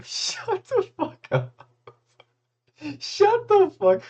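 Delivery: very high, slow squeal through laughter; laughing protest. Real, not acted. Streamer teased about his date, squealing with laughter. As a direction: squeal it out while cracking up.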